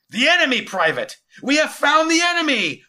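high, loud, triumphant proclamation; rejoicing. Acted. General telling his private of the find, jubilant. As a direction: shout it like a victory cry. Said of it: "rejoiced Solomon".

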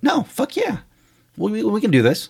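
very high-pitched imitation of an eager partner; gung-ho. Partner reassuring the doubtful filmmaker, gung-ho and high. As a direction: pipe up high with can-do enthusiasm. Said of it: "you're so gung-ho".

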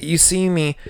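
usual speaking voice

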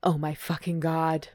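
restrained, mildly acted moan. A narrator's reading. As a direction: let it out as an understated moan. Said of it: "Hunter moans".

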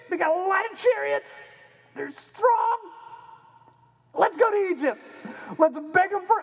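very high, squeaky cartoon voice; high goofy voice of desperate schemers; silly panicked people. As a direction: play the foolish crowd, giddy with a bad idea. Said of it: "they're freaking out".

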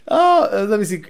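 usual speaking voice

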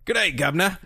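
hammed-up Cockney hail; mock Cockney. Co-host imagining a British actor in character, mock Cockney. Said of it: "if he was all Cockney".

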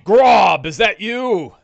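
loud, high orc holler; echoing orc call. Acted. Orc lookout calls out to Grob, hollering. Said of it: "you hear a voice that echoes out".

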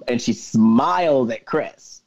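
ordinary talk

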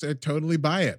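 ordinary talk, nothing marked

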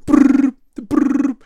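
high, loud rattling brrr of a machine gun; spray the woods with a mouth gun; playful imitated gunfire; machine gun noise. Guy in tower emptying blanks.

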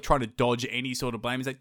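usual speaking voice

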